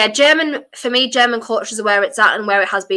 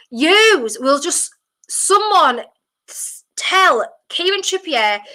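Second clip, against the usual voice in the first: high, slow, drawn-out exasperation; exasperated plea; 7 semitones higher, much slower than usual. Real, not acted. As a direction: plead in disbelief, stretching each word. Exasperated plea after bad free kick.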